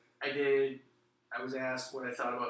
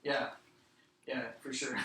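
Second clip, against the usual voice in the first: normal talking voice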